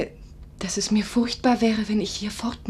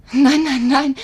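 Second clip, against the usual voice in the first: loud, higher repeated no; eager protest; 6 semitones higher, 11 dB louder, slower than usual. Acted. Nurse eagerly denying his doubts about marriage, loud. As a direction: burst out in eager denial.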